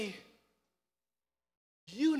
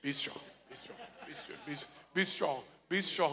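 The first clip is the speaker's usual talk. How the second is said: usual speaking voice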